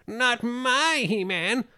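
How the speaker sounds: high, slow, nasal-free mock fanboy whine; mock an outraged purist fan, stretching it out; high drawn-out fanboy parody; whiny fanboy. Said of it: "those guys that's like".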